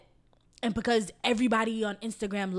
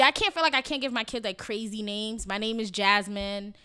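normal talking voice

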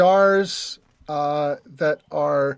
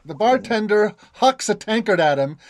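normal talking voice

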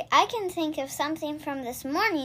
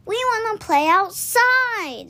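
put-on higher, slow pleading kid bunnies; whiny little rabbits; 7 semitones higher, 5 dB louder, slower than usual. A narrator's reading. Naughty rabbits beg their mama, high and slow. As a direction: beg to go out and play. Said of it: "they cried".

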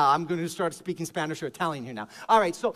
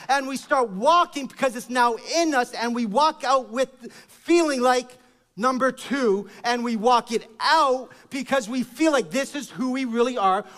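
higher and louder sermon push; fervent preaching; 6 semitones higher, 4 dB louder than usual. Real, not acted. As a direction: drive the message home. Preaching at full force.

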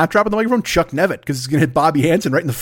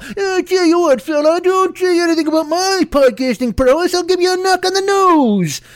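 put-on Bowery Boys tough guy; 14 semitones higher than usual. Defending his podcasting prowess to a tweeter, Bowery Boys threat. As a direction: threaten like a 1940s street punk. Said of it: "I sound like one of the Bowery Boys".

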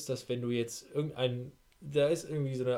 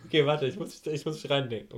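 stifled giggles; 3 dB louder than usual. Real, not acted. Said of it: "Alter, hör auf zu lachen".

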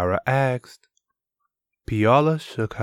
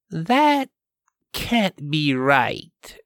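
"That" jumps high, then slow; stunned disbelief; 8 semitones higher, slower than usual. Acted. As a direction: catch your breath on the first word. Diana stunned by the name.

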